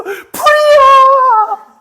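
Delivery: high, very drawn-out cry; hammed-up sobbing shout, last syllable held; acted tearful wail. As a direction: wail it out as if overwhelmed to tears. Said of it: "울면서".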